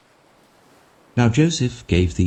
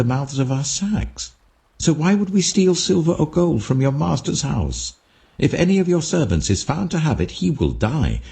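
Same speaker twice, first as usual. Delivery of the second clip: higher, quicker, pleading denial; urgent protest; 9 semitones higher than usual. Acted. Brothers insist to the steward they stole nothing, urgently. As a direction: protest your innocence, pressing.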